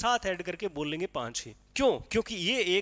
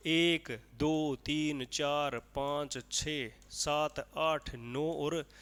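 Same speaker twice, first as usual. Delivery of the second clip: slow counting; 3 semitones lower, 3 dB quieter, much slower than usual. Shown as a demonstration. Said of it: "कितने हो गए?".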